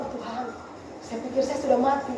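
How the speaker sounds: low, soft gasp to God; hushed retold prayer. Preacher reliving waking up thinking she died, hushed prayer. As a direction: drop to an under-the-breath murmur. Retelling waking in fright at night.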